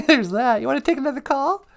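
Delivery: very high, small comic voice; high-voiced sidekick. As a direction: pipe up as the host's curious sidekick. Sidekick figure beside host.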